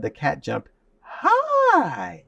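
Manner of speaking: "high" lifted in pitch and held very long; inflection on high. Shown as a demonstration. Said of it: "That inflection made it different".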